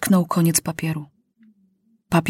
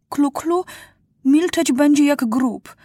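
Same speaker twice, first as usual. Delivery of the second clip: higher, earnest warning, fading slower at end; a worried girl warning her king; Klu-Klu voice; 8 semitones higher than usual. A narrator's reading. Klu-Klu warns Maciuś of the kings, higher and earnest.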